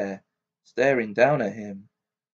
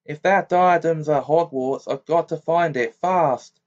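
urgent; 5 semitones higher, 6 dB louder than usual. A narrator's reading. Harry pressing urgently.

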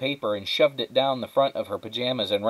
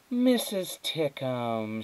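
drawn-out, sugary greeting; slow, higher, put-on politeness; fake adult smile; 4 semitones higher, 3 dB quieter, much slower than usual. A narrator's reading. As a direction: greet the visitor with forced delight. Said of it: "with a big, fake adult smile".

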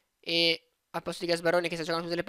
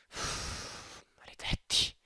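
whispered curse; 11 dB quieter, much slower than usual. Real, not acted. Frustration over transfer fee.

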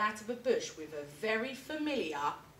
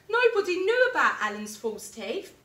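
higher, louder storyteller reveal; big reveal; 10 semitones higher, 10 dB louder than usual. A narrator's reading. Telling children Alan's secret, excited reveal. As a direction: let the secret burst out.

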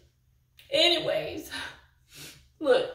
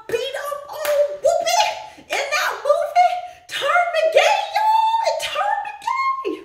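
mock squeal; 11 semitones higher than usual.

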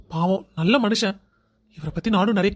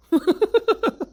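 short high teasing giggle; a quick high laugh; 11 semitones higher than usual. Acted. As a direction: giggle at a friend's stammering. Laughing at his friend.

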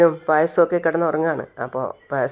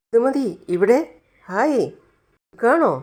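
higher, delighted surprise at seeing her; surprised; 6 semitones higher than usual. Acted. Neelan in his dream, greeting Indumathi, higher and surprised. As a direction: gasp out her name, amazed she's here.

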